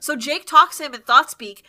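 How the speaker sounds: ordinary talk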